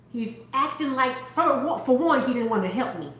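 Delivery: usual speaking voice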